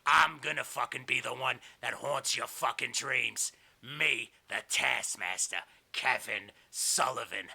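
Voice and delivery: slow, deliberate villain's threat; Kevin Sullivan menace. Acted. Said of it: "And he's like".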